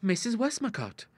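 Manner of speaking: high, sly teasing suggestion; a hint of slyness. A narrator's reading. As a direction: slip the name in mischievously. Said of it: "suggested Ida, slyly".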